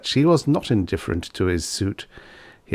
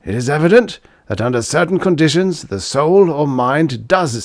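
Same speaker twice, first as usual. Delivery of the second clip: louder and higher, a lecturing professor proclaiming; professor's declaration; 6 semitones higher, 4 dB louder than usual. Acted. Professor proclaiming his theory to the scientific world, loud and high. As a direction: announce it like a confident scholar.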